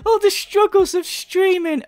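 high-pitched sarcastic lament; mock whining echo. Commentator mocks streamer's struggles of streaming, high whine. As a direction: wail the quote in mock self-pity.

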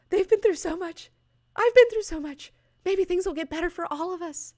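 very high, choked-up fan voice; mock emotional. Fan reacting to the party panel, in a very high mock-tearful gush. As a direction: gush as if near happy tears. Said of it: "I'm, like, getting so emotional right now".

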